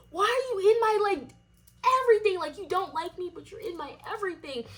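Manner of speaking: high-pitched, incredulous retold rant; indignant, pitched well above normal talk; exasperated questioning. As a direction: challenge a rival in a whiny high voice. So I'm just like.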